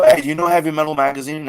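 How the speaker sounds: bright, quick eager question; excited question. Retold or imitated. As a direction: ask it eagerly, fast. Acted question to a stranger.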